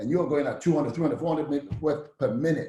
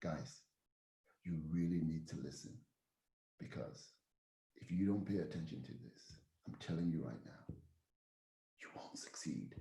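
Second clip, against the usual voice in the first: much softer, lower, almost whispered; hushed urgent warning; 7 semitones lower, 17 dB quieter than usual. Shown as a demonstration. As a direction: draw them in with a quiet warning. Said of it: "there are moments where you have to say".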